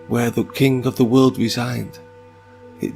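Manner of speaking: ordinary delivery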